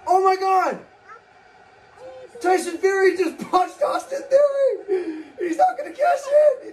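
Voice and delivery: shouting and half laughing in disbelief; excited shout. Real, not acted. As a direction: yell it, stunned and thrilled. Tyson Fury run-in shock.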